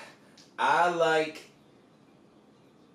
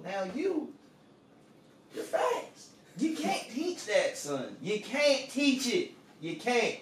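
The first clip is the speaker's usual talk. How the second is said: Southern coach drawl. Pretend. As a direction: play a folksy Southern coach sizing up a recruit. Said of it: "Nick says".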